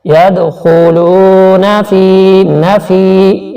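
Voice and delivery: slow chanted tajweed recitation; Quran recitation. Shown as a demonstration. Teacher demonstrating recitation to students, slow and chanted. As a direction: draw out each syllable in melodic recitation. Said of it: "نون زبر نا کو نہیں کھینچنا".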